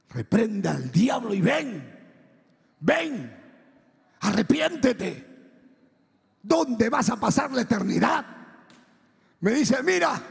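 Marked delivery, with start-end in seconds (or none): 0.1-8.3 building, drawn-out cry of exhortation; preacher's urgent call. Retold or imitated. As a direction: plead with a sinner, rising as you go. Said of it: "comienza a decir, arrepiéntete".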